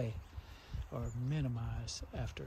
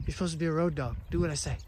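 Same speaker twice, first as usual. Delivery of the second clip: mock scolding; 2 semitones higher, 6 dB louder, much faster than usual. Real, not acted. Scolding the dog.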